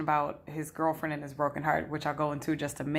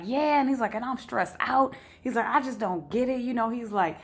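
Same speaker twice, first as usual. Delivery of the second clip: stressed client voice; 6 semitones higher than usual. Retold or imitated.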